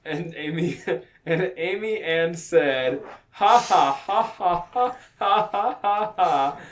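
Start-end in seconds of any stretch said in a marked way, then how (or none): 3.3-6.6 loud, high spelt-out ha-has; mock laughter. A narrator's reading. Narrator voicing Amy's laughter, hammed-up. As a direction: punch out each ha as a fake laugh. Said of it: "and laughed".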